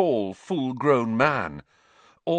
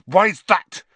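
higher, louder, spitting contempt; spat; 8 semitones higher, 9 dB louder than usual. Acted. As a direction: spit it at his enemy. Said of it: "Harry spat".

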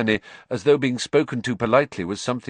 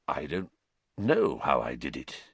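slowly; 7 semitones lower, 5 dB quieter than usual. A narrator's reading. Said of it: "he said slowly".

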